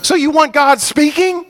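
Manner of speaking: high, loud, slow exhortation building to a shout; fiery preacher calling the church to read the Bible; preaching at full force. Real, not acted. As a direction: drive it home to the congregation.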